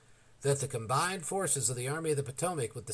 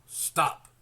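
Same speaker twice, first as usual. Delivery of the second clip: sharp stop; 5 dB louder than usual. A narrator's reading. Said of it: "McClellan said".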